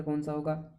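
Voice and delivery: whispered question. Acted. Quiz question to viewers. (फुसफुसाकर सवाल)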